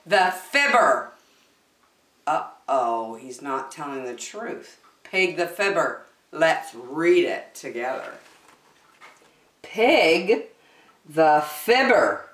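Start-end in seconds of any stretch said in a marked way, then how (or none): none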